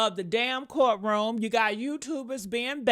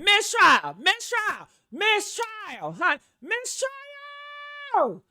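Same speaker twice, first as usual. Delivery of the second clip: rising, high church call, stretched out at the end; church-style cry; 10 semitones higher, 3 dB louder, much slower than usual. Acted. Mocking the defense's demand, hammed-up church cry. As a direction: cry it out like a congregation, higher each time. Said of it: "like they in church or something".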